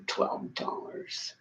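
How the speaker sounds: slow, breathless whisper of the price; a slow, hushed, disbelieving repeat of the price; whispered disbelief. Real, not acted. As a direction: let the price sink in, hushed and incredulous. Scoffing at the cup price.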